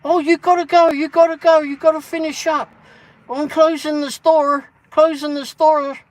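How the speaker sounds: high, loud urgent yelling; mock shopkeeper shouting to hurry up; retold shout. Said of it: "someone shouts in".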